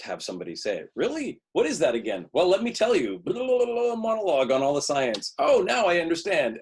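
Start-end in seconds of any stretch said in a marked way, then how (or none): 0.9-3.2 high-pitched, loud hammy scene partners; overeager, squeaky-high stock characters; mock exposition dialogue. 3.2-5.3 high, loud blah-blah send-up; hammed-up mock monologue, pitched high; mocking gabble. Mocking a boring exposition monologue. 5.4-6.6 high, loud hammy realization; overdone, high-pitched mock understanding; mock enlightenment. Mock listener in expository scene.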